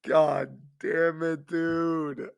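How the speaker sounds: drawn out slowly while still laughing; slow laughing groan. Real, not acted. Host cracking up over the Pistons, slow laughing moan. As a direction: stretch it out through the giggles. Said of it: "I can't stop laughing".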